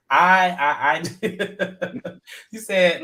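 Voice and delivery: stammering through real laughter; laughing so hard the words stall. Real, not acted. Recapping the show, cracking up mid-quote. Laughing while retelling Jen.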